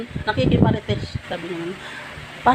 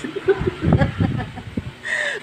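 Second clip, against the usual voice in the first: a long high rising giggle; 9 semitones higher than usual. Real, not acted. Amused at the reformed man, high giggle. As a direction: let out an amused high laugh. Laugh alone.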